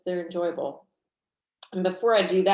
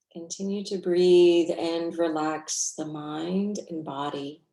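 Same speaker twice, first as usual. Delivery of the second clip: guided meditation. Real, not acted. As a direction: guide them gently through the breath, unhurried.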